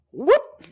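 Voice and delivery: sudden high, loud whoop; cartoonish high "whoop!". Acting out clothes flying off.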